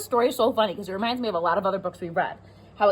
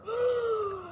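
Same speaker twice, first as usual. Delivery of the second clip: frightened gasp; 14 semitones higher, 5 dB quieter than usual. Acted. Scary reveal in story.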